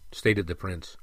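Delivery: plain reading voice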